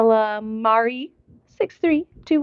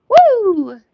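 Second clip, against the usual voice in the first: high, loud triumphant whoop; excited cheer; 10 semitones higher, 8 dB louder than usual. Real, not acted. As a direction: celebrate the win out loud. Barrel finally clean.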